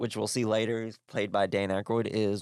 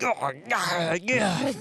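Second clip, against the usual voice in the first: panicky; 8 semitones higher, much slower than usual. Retold or imitated. Tommy freaking out.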